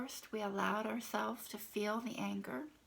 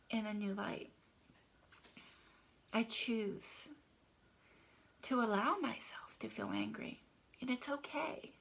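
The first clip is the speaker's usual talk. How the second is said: hushed, intimate affirmation read; tapping affirmation. Shown as a demonstration. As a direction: murmur it gently to yourself while tapping. Tapping script demo.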